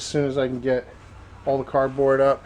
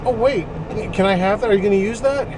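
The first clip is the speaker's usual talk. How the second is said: eager ask; 6 semitones higher, faster than usual. Retold or imitated. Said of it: "And I said".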